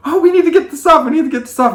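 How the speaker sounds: high, anxious mock mom panic; mom panicking. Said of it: "her mom starts panicking".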